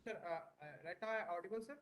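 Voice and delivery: faint, distant mic voice; speaking too softly into the mic; barely audible. Real, not acted. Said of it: "Your voice is very low".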